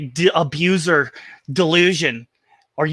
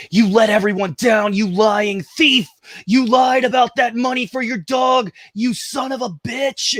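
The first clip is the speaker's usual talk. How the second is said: higher, rising into a shouted accusation; retold troll tirade, yelling; mock angry rant; 7 semitones higher, slower than usual. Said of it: "and I was like".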